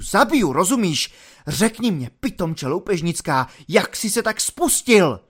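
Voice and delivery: high, loud angry dressing-down; officer's tirade, pitch jumping up; furious lieutenant. Acted. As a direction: rage at the soldier, flaring up in bursts.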